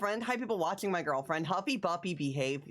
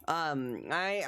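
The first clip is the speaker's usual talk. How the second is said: usual speaking voice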